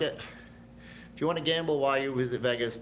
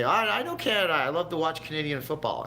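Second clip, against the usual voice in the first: teasing; 7 semitones higher, 4 dB louder than usual. Retold or imitated. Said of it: "And I'd say".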